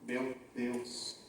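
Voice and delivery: very soft, low murmur; mother's murmur. Acted. Mother seeing her child pass in drag, murmuring. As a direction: murmur it under the breath. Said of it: "eu ouço ela murmurar".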